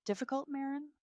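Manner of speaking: high-pitched incredulous echo of 'difficult'; wounded disbelief. A narrator's reading. Wife answering her mother-in-law, wounded disbelief. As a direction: throw the word back as a hurt question. Wife echoes the accusation back.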